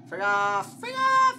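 loud shout leaping to high scream; yell, then shriek it; frantic shout. Real, not acted. Player drives off game monster, frantic screaming. Same words escalate to scream.

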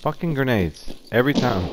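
high, loud angry burst; frustrated outburst. Real, not acted. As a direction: snap it out, fed up.

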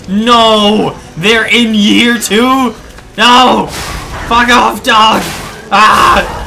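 loud panicked yell at dog. Real, not acted. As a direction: shout in panic. Yelling at attacking dog.

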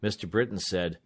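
ordinary delivery, nothing marked

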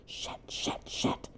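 hissed under breath; whispered panic while sneaking; whispered curse. Real, not acted. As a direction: swear without a sound.

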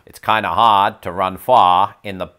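loud, broad Boston r-dropping; Boston accent. Shown as a demonstration. Demonstrating chosen words in a sentence, loud Boston accent. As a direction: belt out the Boston "ah" sounds. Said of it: "a Bostonian accent".

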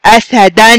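أسدا with a clear ringing final -an; fatha tanween. Shown as a demonstration. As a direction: say أسدا as "asadan", ending on a held n. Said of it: "سامعين يا أصدقائي؟". (نطق أسدا بتنوين الفتح)

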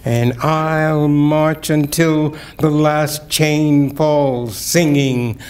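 solemn, building poetry recital; poem reading. Acted. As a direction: read it like a testimony. Reads a poem aloud.

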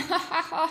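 mock evil ha ha ha; evil shark laugh. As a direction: laugh like a villain. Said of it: "he laughed".

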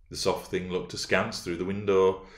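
plain reading voice

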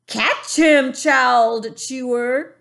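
high, loud giant yell; giant shout. A narrator's reading. Giant tossing the BFG to another, a loud shout. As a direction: fling it out like a playground taunt. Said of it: "he shouted".

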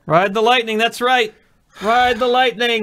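high, loud rallying cry; hyped cheer. Real, not acted. Friend cheering the player through a shot, hyped.

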